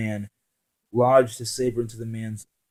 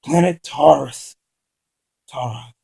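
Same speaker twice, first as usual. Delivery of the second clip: frightened; 4 semitones higher, 9 dB louder than usual. Acted. Terrified captive answers.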